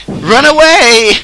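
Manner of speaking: high, loud comic cry; mock panicked retreat. Voicing two escaping hover discs, mock panicked shout. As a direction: shout it as a hammed-up battlefield getaway. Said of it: "these two hover discs escaping".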